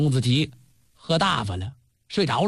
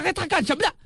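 alarmed shout; 9 semitones higher, much faster than usual. Acted. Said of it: "大伙儿一看急了".

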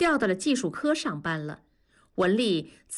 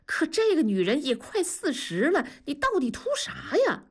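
higher, urgent coaxing; worked-up; 4 semitones higher than usual. Acted. Buddy pleads with his friend, voice rising higher. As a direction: press your buddy hard, voice climbing. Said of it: "狠拍大腿说".

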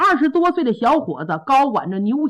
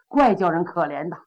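ordinary delivery

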